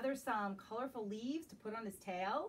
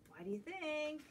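ordinary delivery

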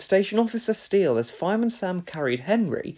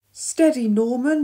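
high, loud steadying call; cautioning cry; 9 semitones higher, 5 dB louder than usual. A narrator's reading. Firefighter inching down ladder with boy, cautioning cry. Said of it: "she cried".

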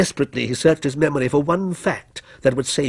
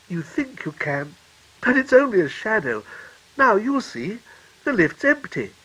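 lightly voiced mother; 6 semitones higher than usual. A narrator's reading. Mother assures her child the lift is empty, patient. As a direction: patiently talk the child round.

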